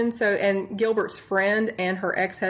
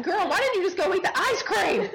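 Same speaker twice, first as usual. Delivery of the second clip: high, laughing mock scolding of the killer; exasperated girl-talk, cracking up; sassy scolding; 8 semitones higher than usual. Podcast host scolding the killer over ice cream, high and laughing. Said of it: "I'm just like".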